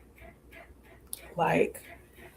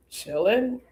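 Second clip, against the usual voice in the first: nonchalant; 6 semitones higher than usual. Retold or imitated. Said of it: "And I was so nonchalant".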